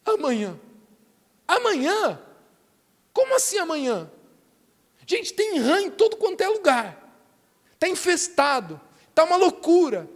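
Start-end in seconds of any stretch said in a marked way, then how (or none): 1.5-10.1 high-pitched acted disbelief; incredulous. Preacher questioning Pharaoh's answer amid the frogs, incredulous. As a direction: protest it as utter madness, voice pitched up.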